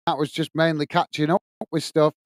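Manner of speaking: ordinary talk, nothing marked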